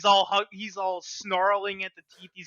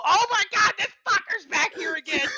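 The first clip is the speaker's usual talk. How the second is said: much higher and louder, crying shout; panicked wail; 13 semitones higher, 4 dB louder than usual. Acted. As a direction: wail in mock despair as the enemy returns. Voicing Gretel seeing Nier return.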